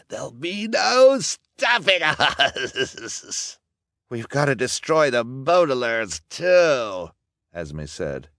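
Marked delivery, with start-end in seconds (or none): 0.1-3.6 slow, loud, high triumphant gloat; gloating villain. A narrator's reading. Villain boasts to his gang, gloating wildly. 4.1-7.1 high, loud vicious insistence; emphatic villainess. A narrator's reading. Villainess urges her boyfriend to destroy the children, insistent.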